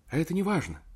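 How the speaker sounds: a touch of heated, eager protest; heated objection. A narrator's reading. Secretary eagerly dismissing his wife, a mild heated objection. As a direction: brush his worry aside, eagerly. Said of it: "горячо возразила она".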